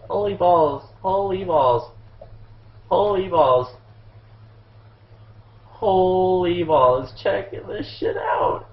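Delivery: high-pitched, stunned repeating; awestruck exclaiming. Real, not acted. Still amazed by the play.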